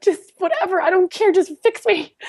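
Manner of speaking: high pleading voice, begging to be fixed; desperate plea. Retold or imitated. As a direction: beg her, voice pitched high.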